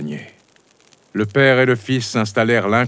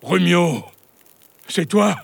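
surprised father; 8 semitones higher than usual. A narrator's reading. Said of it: "une grosse voix demanda".

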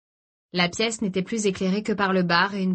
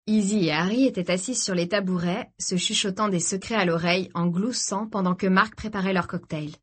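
ordinary delivery, nothing marked

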